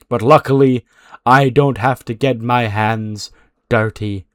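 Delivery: slow, deliberate acted warning; unhurried villain's pronouncement with pauses; measured threat. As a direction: spell out a threat word by word. Acted wrestler's veiled threat.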